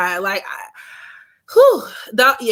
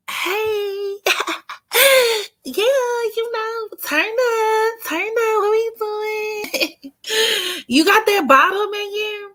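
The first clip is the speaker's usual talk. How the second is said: high giggly put-on voice, much higher; fake girly voice; 12 semitones higher, slower than usual.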